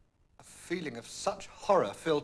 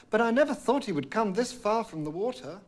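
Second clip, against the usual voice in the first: mild cry, higher and louder; a touch of distress; princess crying out; 8 semitones higher, 8 dB louder than usual. A narrator's reading. Princess confessing her promise to the king, mild distress. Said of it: "his youngest daughter cried".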